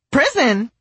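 high, loud alarmed shriek. Retold or imitated. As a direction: shriek the word in shock. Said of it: "I shrieked".

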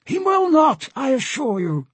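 very high-pitched interruption; assertive captain. Acted. Captain assures diplomat they won't be caught, high voice. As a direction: cut in high and sure.

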